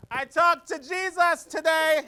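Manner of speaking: high, slow proclamation; crazy train rider. Retold or imitated. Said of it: "here he goes".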